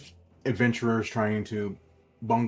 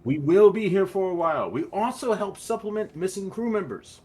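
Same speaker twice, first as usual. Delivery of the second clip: high-pitched game character explaining the job; non-player character; 9 semitones higher, 7 dB louder than usual. Acted. Character tells visiting crew about their services, high-pitched.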